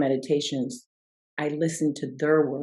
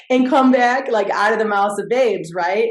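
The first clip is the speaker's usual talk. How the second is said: louder, higher, talking through laughter; laughing; 6 semitones higher, 8 dB louder than usual. Real, not acted. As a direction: still laughing as she speaks. Laughing at son's suggestion.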